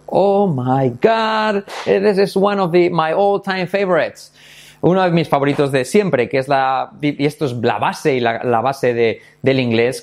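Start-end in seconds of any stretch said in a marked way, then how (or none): 0.1-4.3 high, drawn-out excited exclamation; theatrical delight, stretched out and higher; gushing delight. Real, not acted. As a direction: gush slowly over a beloved topic. Gushing over a favourite question.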